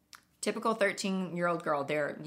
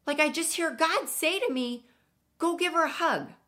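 higher girlish retelling, lightly voiced; imitating the teen; 8 semitones higher, 3 dB louder than usual. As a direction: tell it as the earnest young girl. Teen retells God story.

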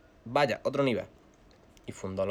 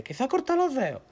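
aunt alarmed; 3 dB louder than usual. Retold or imitated. Aunt answers uncle in alarm.

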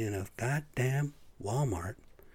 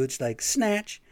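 snatch said much higher and slowly drawn out; dramatic snatch; 9 semitones higher, much slower than usual. Acted. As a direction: pounce on snatch. Acting out virus grabbing.

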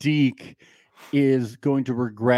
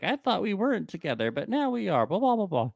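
lightly voiced higher whine; mock Jillian; 8 semitones higher, 4 dB quieter than usual. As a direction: imitate her breezily, a bit higher.

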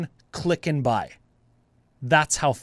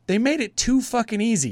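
exasperated disbelief; 7 semitones higher than usual. Retold or imitated. Retold quote to bank agent.

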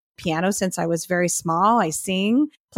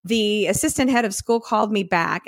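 normal talking voice

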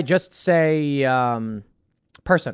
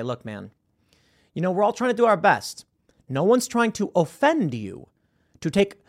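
ordinary talk

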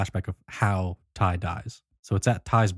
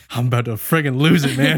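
mock exasperated outburst, raised voice; crumbling; 5 semitones higher, 5 dB louder than usual. Will crumbles as the movie goes on, mock-exasperated. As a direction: play a man near breaking, lightly. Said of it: "You can see him crumbling".

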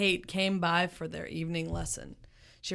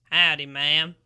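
booming greeting; 2 semitones lower, 7 dB louder than usual. Acted. Big Joe greeting, loud.

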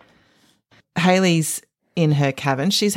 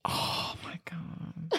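breathless shocked whisper; 15 dB quieter, much slower than usual. Real, not acted. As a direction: gasp it out in a whisper. Shocked at filthy question.

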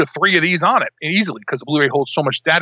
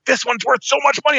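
mock seller voice; 9 semitones higher than usual. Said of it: "And they were like".

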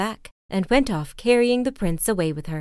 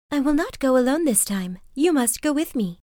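put-on higher, pleading young woman; Lily, higher voice; 5 semitones higher than usual. A narrator's reading. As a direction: insist gently as the heroine. Lily speaks to prince.

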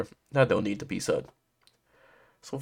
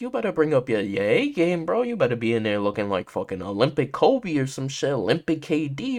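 ordinary talk

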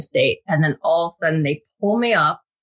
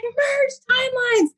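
mock screamed order; slower than usual. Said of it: "And he's like".

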